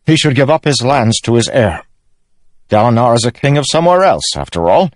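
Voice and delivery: high, loud improvising king; insistent young king. Acted. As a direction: work out the titles aloud, excitedly. Narrator voices Elhokar.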